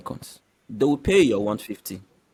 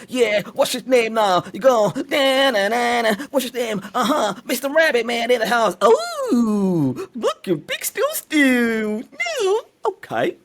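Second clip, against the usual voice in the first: much higher, wild cartoon voice with laughing bursts; zany genie voice; 11 semitones higher, 3 dB louder, slower than usual. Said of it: "I'm going to do a scene from Missus Doubtfire".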